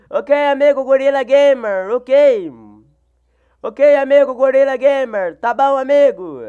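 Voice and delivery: bouncy thanks to a viewer; cheery shout-out. Real, not acted. Streamer acknowledging viewer loudly.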